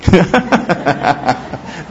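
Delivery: real burst of laughter; hearty laugh. Real, not acted. Priest amused by his own story of the farm, hearty laugh.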